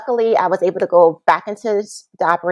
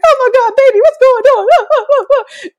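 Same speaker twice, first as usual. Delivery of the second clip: much higher, louder, slow worried mom; alarmed mother; 6 dB louder, slower than usual. Retold or imitated. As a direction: stretch it out like a frightened mother.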